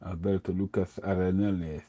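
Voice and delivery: Lucas said with a lisped th in a Spanish accent. Shown as a demonstration. Giving the Spanish roller's full name in a lisping Spanish accent. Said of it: "get my lisp on".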